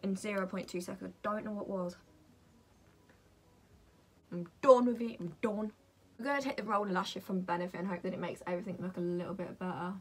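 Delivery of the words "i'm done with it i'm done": loud, exasperated outburst; having a paddy. Real, not acted. Vlogger giving up on the eyeshadow, loudly fed up. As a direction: burst out loudly, then ease off. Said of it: "I'm having a paddy".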